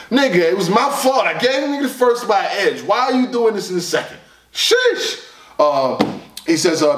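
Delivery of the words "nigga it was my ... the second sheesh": high-pitched, overwhelmed pleading; exasperated protest. Real, not acted. Reviewer begging the rapper to ease up, exasperated.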